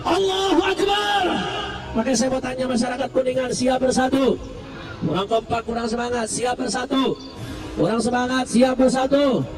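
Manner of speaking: ordinary delivery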